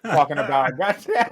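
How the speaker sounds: ordinary talk, nothing marked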